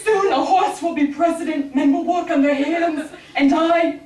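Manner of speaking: hammed-up breathless lament; mock-doomed old woman; exhausted woman wailing at fate; panting despair. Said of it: "saying things like".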